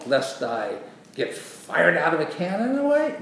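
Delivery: hammed-up disbelief, rising high; mock incredulous question. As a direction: make the absurd idea sound explosive. Second mock misreading, absurdly dramatic.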